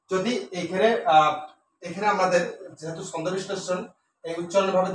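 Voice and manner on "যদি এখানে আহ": ordinary talk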